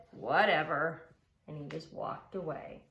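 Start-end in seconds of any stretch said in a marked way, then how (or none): 0.1-1.1 dismissive pug. Acted. Pig dismisses Trevor.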